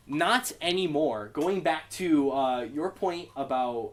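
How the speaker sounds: normal talking voice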